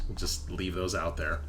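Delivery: ordinary talk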